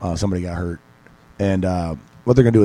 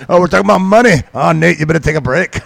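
higher, louder mock ogre voice; Shrek; 7 semitones higher, 5 dB louder than usual. Joker ribbing fighters about money in an ogre voice. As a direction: ham up a cartoon ogre's accent. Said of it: "He sounds like Shrek".

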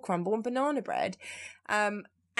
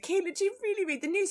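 teasing friends; 11 semitones higher, faster than usual. Retold or imitated. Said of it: "They're, like, laughing at me".

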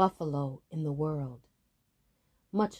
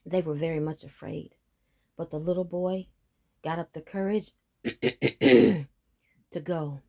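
usual speaking voice

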